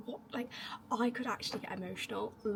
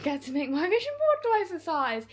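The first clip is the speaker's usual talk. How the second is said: laughing; 8 semitones higher, 11 dB louder than usual. Real, not acted. Excited about bigger vision board.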